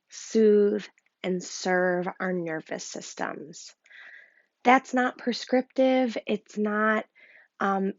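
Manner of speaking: ordinary delivery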